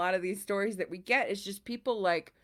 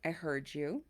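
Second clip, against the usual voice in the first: calm reassurance; 5 semitones lower, 9 dB quieter than usual. Retold or imitated. Calming husband with set phrase.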